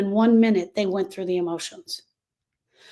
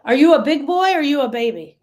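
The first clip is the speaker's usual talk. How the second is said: higher, louder taunting parent voice; mocking parent; 6 semitones higher, 6 dB louder than usual. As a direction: sneer at a small boy like a harsh parent. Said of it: "we're already telling boys that".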